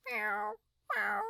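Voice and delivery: high little cat mews; cat meow. A narrator's reading. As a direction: mew like a cat.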